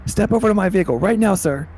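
rising into a loud high command; cop commanding. Acted. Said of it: "he's like".

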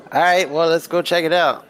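rally the group to go; upbeat in-character line. Acted. Red getting up to go.

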